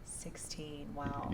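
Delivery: breathy whispered echo of the number; awed whisper. Real, not acted. Host echoing the guest's age in an awed whisper. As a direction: whisper it back in amazement.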